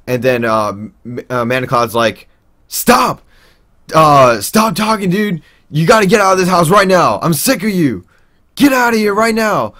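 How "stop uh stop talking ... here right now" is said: high, loud retold yelling; angry yell. Manicloud kicking the narrator out, high angry yell. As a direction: shout him out of the house. Manicloud quoted throwing him out.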